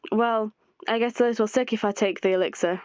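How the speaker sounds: normal talking voice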